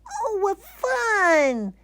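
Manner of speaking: very high, slow gleeful bear; delighted. Acted. Bear sliding on soap flakes, gleeful. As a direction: squeal with joy. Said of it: "he said with a smile".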